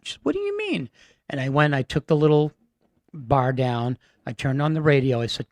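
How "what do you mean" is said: put-on very high old lady voice; old woman. Lena puzzled by Michael's plan, very high frail voice.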